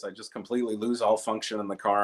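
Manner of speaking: ordinary delivery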